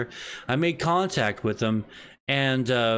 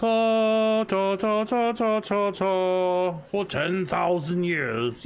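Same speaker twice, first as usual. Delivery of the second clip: dragon voice; 5 semitones higher, much slower than usual. Pretend. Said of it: "It's like".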